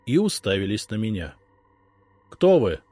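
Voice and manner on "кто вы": sharp. A narrator's reading. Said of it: "резко спросил я".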